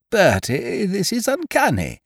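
high, awestruck wonder; astonished, rising pitch; amazed. A narrator's reading. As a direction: marvel at your friend's uncanny knowledge. Amazed at friend's knowledge.

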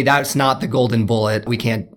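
normal talking voice

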